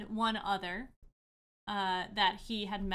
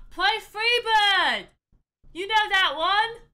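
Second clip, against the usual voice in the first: heckler; 10 semitones higher, 11 dB louder than usual. Acted. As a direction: yell it from the back like a rowdy heckler. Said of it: "Haji goes".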